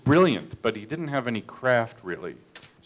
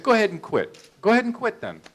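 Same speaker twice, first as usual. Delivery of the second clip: mock defiant dare; 10 semitones higher, faster than usual. Director snaps back at actor.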